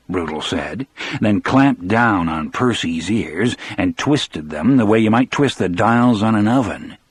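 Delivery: normal talking voice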